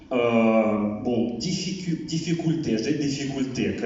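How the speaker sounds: ordinary talk, nothing marked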